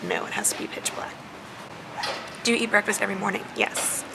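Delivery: ordinary talk, nothing marked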